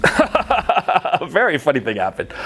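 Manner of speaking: chuckling through the words; laughing. Real, not acted. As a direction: let a laugh run under it. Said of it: "A funny thing happened".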